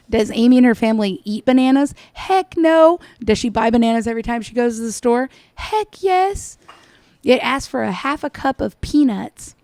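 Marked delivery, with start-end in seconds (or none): 2.1-3.0 mocking emphatic. Real, not acted. Answering own mocking questions. 5.5-6.6 mocking high. Real, not acted. Answering own mocking questions.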